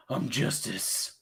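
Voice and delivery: low, slow, hushed Batman imitation; a hint of gravel-free Batman gravitas; mock Batman line. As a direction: intone it like a brooding caped hero. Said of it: "whenever we hear, we all think that".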